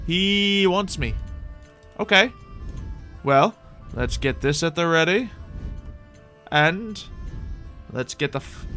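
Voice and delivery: usual speaking voice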